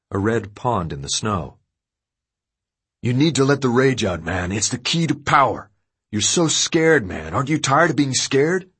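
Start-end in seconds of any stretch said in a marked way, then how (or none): 3.0-8.7 urging. A narrator's reading. Trey urging rage.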